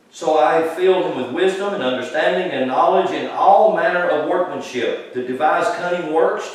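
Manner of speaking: a lifted, deliberate reading of the verses; scripture reading. Acted. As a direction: read it out like the pulpit Bible. Reads scripture aloud to congregation.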